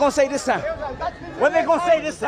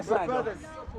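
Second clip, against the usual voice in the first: soft consoling; 6 semitones lower, 13 dB quieter, slower than usual. Real, not acted. Consoling loser softly.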